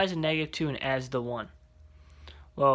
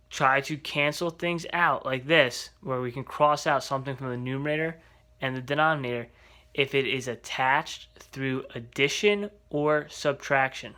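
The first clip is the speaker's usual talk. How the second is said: ordinary talk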